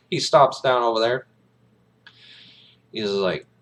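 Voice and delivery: ordinary delivery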